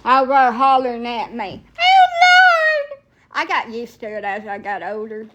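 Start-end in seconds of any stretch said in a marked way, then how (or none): 1.8-3.0 put-on high, wailing old grandma cry; panicked grandma. Grandma in the passenger seat, imitated panicked wail.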